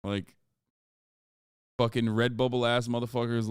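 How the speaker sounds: normal talking voice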